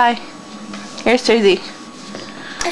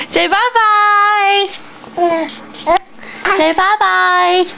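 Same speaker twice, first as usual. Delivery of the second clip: sing-song prompt; 4 semitones higher, slower than usual. Shown as a demonstration.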